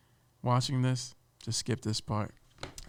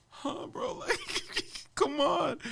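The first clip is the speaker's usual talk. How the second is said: high, airy disbelieving huh then a laugh; incredulous; slower than usual. Retold or imitated. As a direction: play stunned disbelief, laughing it off. Disbelief at Tunde's line.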